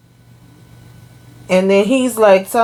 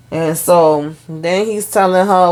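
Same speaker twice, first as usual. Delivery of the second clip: normal talking voice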